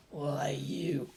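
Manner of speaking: unvoiced furious hiss; whispered rage. A narrator's reading. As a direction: spit it through a whisper. Toji furious.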